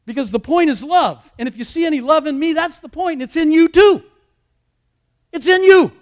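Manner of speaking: high, emphatic preacher's delivery; impassioned preaching. Real, not acted. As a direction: preach it with rising force, peaking loud. Preaching rises above plain talk.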